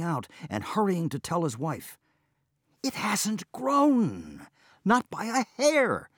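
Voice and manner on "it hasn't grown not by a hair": amazed. Acted. Amazed at his unchanged beard.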